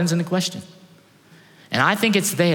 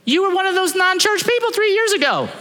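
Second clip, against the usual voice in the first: mock outraged reply to the departing member; exasperated retort; 14 semitones higher than usual. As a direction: throw it back at him in disbelief. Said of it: "And I'm going".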